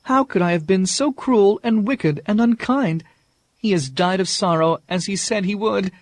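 lamenting over the dead Beast; grieving outcry. Acted. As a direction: heartbroken high lament. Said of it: "she cried".

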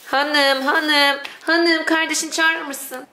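high, urgent cooing calls. Real, not acted. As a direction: coax the pet, urgent and sweet. Calling pet to fetch sibling.

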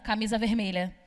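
usual speaking voice

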